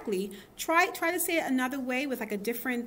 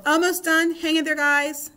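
encouraging; 6 semitones higher, 9 dB louder than usual. Real, not acted. Teacher cheering on the class.

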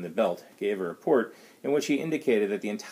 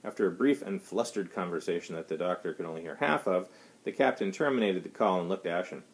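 ordinary delivery

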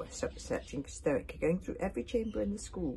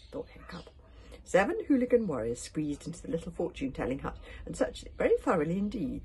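usual speaking voice

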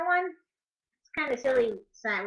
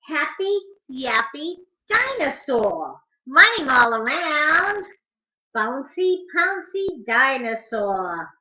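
lively, sing-song picture book rhyme, higher; reading to children; 3 semitones higher, 6 dB louder, slower than usual. Acted. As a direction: bounce the rhymes for little listeners.

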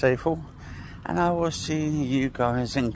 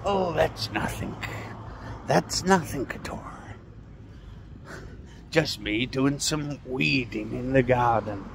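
Ed the gardener; 5 dB quieter than usual. Retold or imitated. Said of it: "Ed the gardener".

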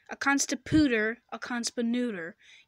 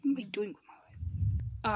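hushed, defeated aside; despairing whisper; 3 semitones lower, 6 dB quieter than usual. Acted. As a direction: whisper it to yourself, hopeless.

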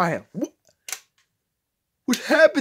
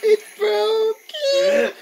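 high, slow wail of dismay; 11 semitones higher, much slower than usual. Pretend. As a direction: wail like a crushed child. Toy broken, wailing.

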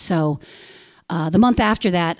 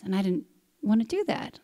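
usual speaking voice

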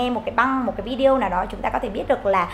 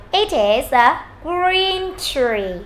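very slow, drawn-out vowels and higher pitch; slow model reading; 4 semitones higher, much slower than usual. Shown as a demonstration. Teacher modelling for learners, slow and careful. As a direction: stretch each word for repetition.

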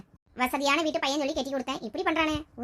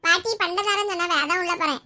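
lightly voiced little kid, higher pitch; child's voice; 3 semitones higher, 4 dB louder than usual. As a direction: answer like an innocent child. Child figure answers aunt. (குழந்தைக் குரல்)

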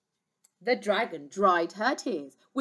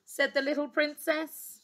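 plain reading voice

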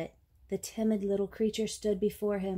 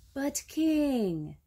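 lightly voiced protesting python, pitched up; a higher, mildly pleading cry; python's cry; 6 semitones higher than usual. A narrator's reading. Python protests to King Lion, mild high cry. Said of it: "he cried".

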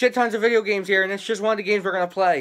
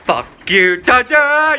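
yelling across the room; 2 semitones higher, 4 dB louder, slower than usual. Real, not acted. Yelling at sibling upstairs.

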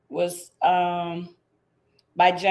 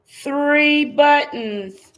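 slow, louder, higher announcement; drawn-out reveal; 6 semitones higher, 8 dB louder, much slower than usual. Acted. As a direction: stretch it out for the big reveal. Big reveal answer.

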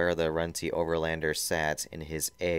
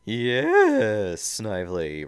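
loud, very high drawn-out seethe; seething Robotnik; 4 semitones higher, 8 dB louder, much slower than usual. Acted. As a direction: seethe out the yes at an underling. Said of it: "Robotnik seethed".

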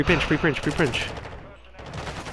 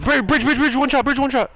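urgent callout; 13 semitones higher than usual. Real, not acted.

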